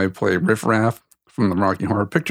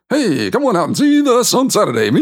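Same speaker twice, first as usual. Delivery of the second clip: high, hammed-up radio announcer; mock radio voice; 13 semitones higher, 4 dB louder than usual. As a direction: put on an over-the-top radio pitch, voice way up. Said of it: "I don't put on a radio voice".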